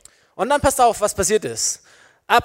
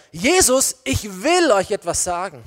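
raised, emphatic opening on Jesus, firm WILL; bold proclamation. Acted. Peter some time after denying Jesus, boldly proclaiming him. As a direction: declare it openly to everyone. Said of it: "wenn Jesus einen Menschen groß macht".

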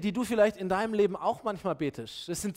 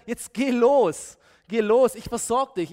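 loud, higher, drawn-out order; forceful command; 4 semitones higher, 7 dB louder, slower than usual. Acted. God orders Moses to get going, loud and emphatic. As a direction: cut through objections and send him.

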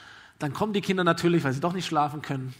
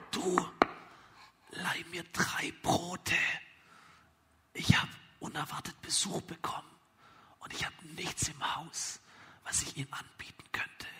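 whispered request; 10 dB quieter than usual. Acted. Friend asking the father for three loaves, whispered request. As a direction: whisper the plea softly.

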